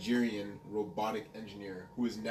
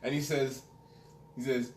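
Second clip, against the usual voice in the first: ordinary delivery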